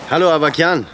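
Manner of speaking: calling out. Acted. Gabriel hails Avakian across the quay, calling out loud. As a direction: hail him from afar.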